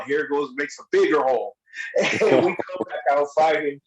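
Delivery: ordinary talk, nothing marked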